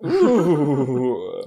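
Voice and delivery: spooky ghostly ooh; low haunting voice. Acted. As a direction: moan it like a ghost. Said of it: "Spooky voices".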